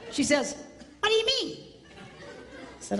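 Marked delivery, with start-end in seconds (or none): 1.0-1.6 high, mock wife voice. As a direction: put on a high wife voice for effect. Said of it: "She doesn't sound like that".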